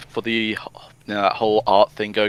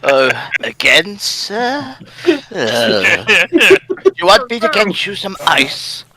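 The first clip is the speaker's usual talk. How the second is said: high, slow timid assistant; meek servant voice; 7 semitones higher, 3 dB louder, slower than usual. Retold or imitated. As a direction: offer it nervously like a servant. Grimer voice answering Robotnik.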